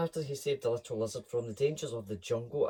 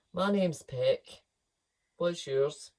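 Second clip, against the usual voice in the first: slow, louder parrot introduction; 4 semitones higher, 5 dB louder, slower than usual. Acted. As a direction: say it grandly and slowly. Peck introducing himself.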